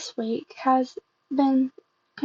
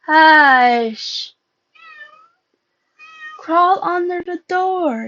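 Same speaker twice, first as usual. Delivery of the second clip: loud hush; 6 semitones higher, 7 dB louder, much slower than usual. Acted. A hushed order in scene.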